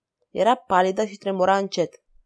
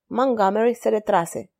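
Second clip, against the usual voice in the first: ordinary delivery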